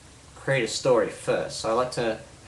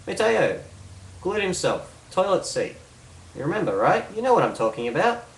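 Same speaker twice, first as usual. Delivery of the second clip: higher-pitched, insistent reminder; insistent repeat; 7 semitones higher than usual. Shown as a demonstration. Speaker repeating a nonsense statement to confuse a listener, insistent. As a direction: prompt them as if they should surely remember. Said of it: "repeat it again to confuse them even more".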